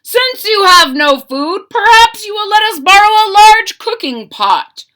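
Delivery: high, loud call to the village. A narrator's reading. Soldier calling to the villagers, loud and high. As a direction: shout it across the square. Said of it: "he called".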